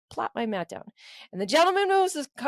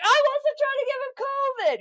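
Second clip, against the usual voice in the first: very high defensive squeal; mock defensive plea; 14 semitones higher than usual. Accused man protesting to the room, mock squeal. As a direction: protest innocence, hammed-up. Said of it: "the guy's like".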